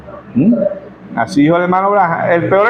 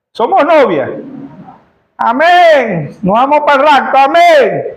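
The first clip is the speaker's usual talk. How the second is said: rousing preacher; 5 semitones higher, slower than usual. Acted. Preacher calling the church to answer amen, rousing. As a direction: fire up the sleepy congregation.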